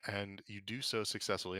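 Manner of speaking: usual speaking voice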